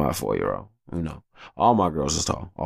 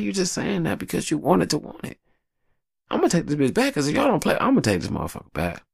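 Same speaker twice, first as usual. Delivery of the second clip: high fast scolding; 12 semitones higher than usual. Retold or imitated. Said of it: "I'm like".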